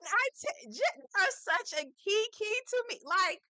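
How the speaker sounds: high, squealing amusement, words stretched out; cracking up. Real, not acted. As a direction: barely get it out, tickled to pieces. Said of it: "It's so fucking funny to me".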